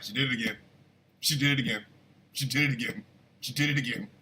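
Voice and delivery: low, soft, deadpan repetition; imitated wife muttering low; wife's hushed report. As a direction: murmur it the same way each time, like a nagging tip-off.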